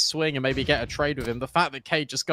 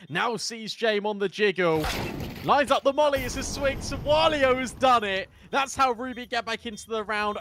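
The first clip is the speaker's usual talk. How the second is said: rising excited play-by-play, higher; hyped esports caster; 9 semitones higher than usual. Real, not acted. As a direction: build the call to a peak. Live clutch call.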